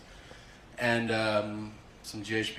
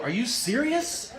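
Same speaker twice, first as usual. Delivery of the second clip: incredulous; 11 semitones higher, slower than usual. Retold or imitated. Nurses react to heroin note.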